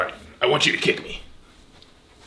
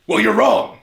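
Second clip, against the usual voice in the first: higher, louder yelled correction; loud retort; 7 semitones higher, 7 dB louder than usual. Acted. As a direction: yell it at the student. Sensei yells at student.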